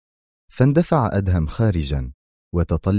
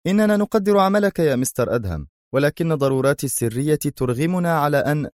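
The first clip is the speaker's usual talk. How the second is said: second agent; 8 semitones higher than usual. A narrator's reading.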